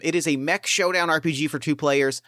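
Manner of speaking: ordinary talk, nothing marked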